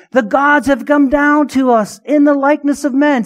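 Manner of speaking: mock amazed worship; awed crowd cry. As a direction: call out in awe like a crowd. Crowd acclaim in Acts.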